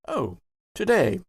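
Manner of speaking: normal talking voice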